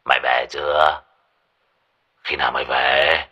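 put-on low, slurred drunk father; drunken slur. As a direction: slur it like a drunk old man. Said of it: "giọng nói nhà lè nhè say xỉn".

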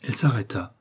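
plain reading voice

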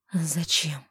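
hoarse breathy whisper; хриплый выдох. Acted. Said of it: "хрипло выдохнул мужчина". (хрипло, на выдохе)

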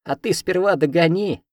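high, cheeky cackling dare; laughing taunt. A narrator's reading. Cat laughs at the chasing heroine. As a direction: toss it back with a laugh. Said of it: "хохочет Хок".

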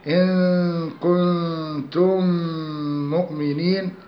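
slow recitation, كنتم مؤمنين joined by a long nasal m; measured Quranic chant holding the m between كنتم and مؤمنين; demonstrated tajweed merging. Shown as a demonstration. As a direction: show the doubled meem by humming from كنتم into مؤمنين. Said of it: "هكذا".

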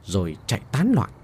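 usual speaking voice

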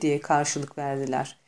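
ordinary delivery

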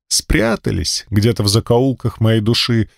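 ordinary delivery

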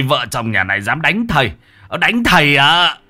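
indignant. A narrator's reading. Beaten medium rebuking the couple, indignant protest. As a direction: end on a loud, rising challenge.

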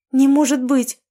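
high, loud gasp of disbelief. A narrator's reading. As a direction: gasp it out, stunned. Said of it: "ахнула я".

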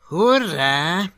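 ordinary delivery, nothing marked

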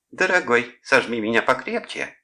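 hammed-up lovey-dovey moaning; feigned amorous moan. A narrator's reading. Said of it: "притворно простонал Клод".

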